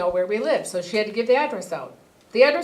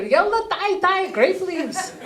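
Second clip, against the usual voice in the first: put-on higher, slow Arabic-accented urging; grandmother urging; 7 semitones higher, slower than usual. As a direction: hurry them along like grandma.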